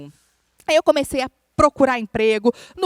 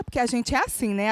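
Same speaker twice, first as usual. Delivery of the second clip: usual speaking voice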